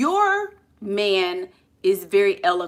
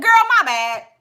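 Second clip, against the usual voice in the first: loud, higher, breezy apology; casual girlfriend; 9 semitones higher, 6 dB louder than usual. Acted. Showing how a girlfriend would apologise casually, loud and easy. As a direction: toss it out like girlfriends talking. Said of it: "She could have said".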